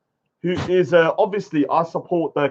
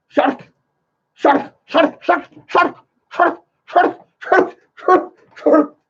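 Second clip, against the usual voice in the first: hammed-up frantic calling, rising higher; mock shouting; 9 semitones higher, slower than usual. Imitating Salahuddin yelling Shark again and again. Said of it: "Salahuddin going".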